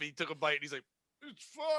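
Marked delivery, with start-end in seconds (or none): 1.2-1.7 high, tiny imitation of fake toughness; mock brave squeak, barely voiced; feigning toughness. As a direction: play tough with almost no voice. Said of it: "trying to be all tough".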